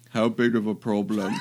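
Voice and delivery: laughing. Acted. Breaks character laughing.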